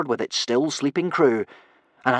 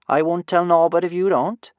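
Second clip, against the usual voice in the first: higher voice, lifting at the end; lightly voiced conspirator, pitched up; character's cheeky offer; 6 semitones higher, 4 dB louder than usual. A narrator's reading. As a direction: offer a secret deal in a raised character voice. Kamikaze's line to Red Ronald.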